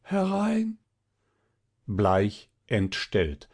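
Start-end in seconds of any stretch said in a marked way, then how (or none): 0.0-0.8 high, strained call of a sick man; sick weak voice. A narrator's reading. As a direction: call out feebly from the sickbed. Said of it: "rief eine kranke, matte Stimme". (kranke, matte Stimme)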